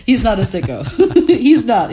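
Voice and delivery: laughing while protesting; giggling through the words. Real, not acted. Laughing at own joke about friend.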